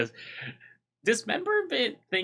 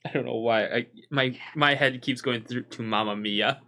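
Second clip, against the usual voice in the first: ordinary delivery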